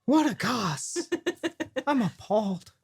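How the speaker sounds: a light hammed-up Cockney lady, appalled; mock Cockney lady. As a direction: play a shocked Cockney gossip. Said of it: "like, one Cockney lady".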